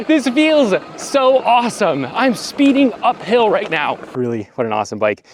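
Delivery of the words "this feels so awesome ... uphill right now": higher and slow, savouring the thrill. Real, not acted. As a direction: stretch out the joy of racing uphill. Thrilled rider speeding uphill.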